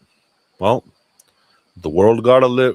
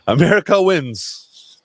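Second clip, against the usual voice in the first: higher and slow, hammed-up triumph; mock patriotic cheer; 9 semitones higher, much slower than usual. Host mocking the president's fans, in a hammed-up slow cheer. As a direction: sell it like a sarcastic campaign slogan.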